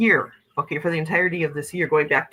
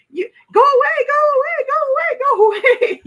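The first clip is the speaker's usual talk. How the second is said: put-on high, loud, frightened child pleading; scared child's plea; 8 dB louder, faster than usual. Child hiding under blankets begs the face to leave, high frightened pleading. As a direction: squeak it out like a terrified kid under the covers. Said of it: "put the blankets over my head and went".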